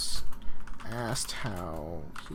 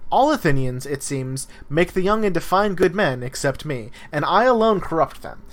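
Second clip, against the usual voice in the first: lively, louder text reading with Socrates's pointed questions; dramatic reading aloud; 3 semitones higher, 7 dB louder, faster than usual. Acted. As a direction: read the dialogue as if cross-examining in court. Reading Plato's Apology aloud.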